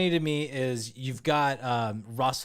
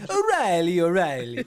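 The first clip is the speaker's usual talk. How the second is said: louder, high cartoon Italian take on O'Reilly; mock Italian-Irish accent; 4 semitones higher, 8 dB louder than usual. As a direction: ham up O'Reilly like a pizza chef. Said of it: "It sounded more Italian to me".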